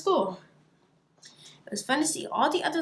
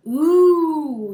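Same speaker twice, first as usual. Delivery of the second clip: high, loud, drawn-out ooh of wonder; a whole class gasping in delight; awed ooh; 6 semitones higher, 11 dB louder than usual. Acted. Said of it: "said all the little crocodiles".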